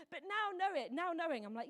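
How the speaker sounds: usual speaking voice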